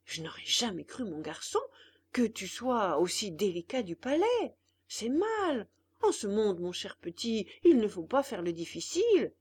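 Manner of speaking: put-on an old man teaching patience; lecturing old father. Geppetto lectures the puppet.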